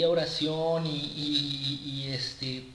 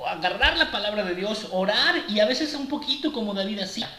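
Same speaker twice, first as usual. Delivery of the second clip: higher and louder, urging the congregation; fervent preaching; 4 semitones higher, 3 dB louder than usual. Acted. Preacher rebuking the flock, louder and higher. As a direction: drive the point home from the pulpit.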